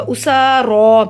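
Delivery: उसारा very slowly, both सा and रा held; slow recitation of उसारा with long vowels. Shown as a demonstration. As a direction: stretch सा and रा in उसारा. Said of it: "एक अलिफ के बराबर लंबा होगा".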